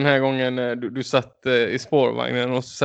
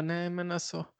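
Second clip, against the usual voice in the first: soft, higher-pitched meek objection; meek protest; 5 semitones higher, 13 dB quieter than usual. Retold or imitated. Imitating a conflict-shy friend objecting, meek. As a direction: imitate a timid, half-hearted no. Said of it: "Och du bara".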